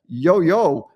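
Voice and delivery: loud call across the yard; a moderate mock holler. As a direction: yell it out to the house. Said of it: "Just yell out".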